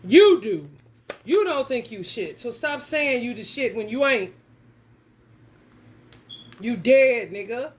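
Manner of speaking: loud scolding shout. Real, not acted. Vlogger shouting down men who brag, as a loud scolding yell. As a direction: yell it at the braggarts.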